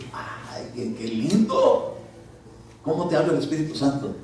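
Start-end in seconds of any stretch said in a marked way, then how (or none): none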